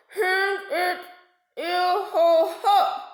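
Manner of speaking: high, very slow gummy threat; toothless warning. A narrator's reading. Toothless shark warns narwhals he'll return, slow gummy threat. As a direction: threaten through bare gums. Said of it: "he warned".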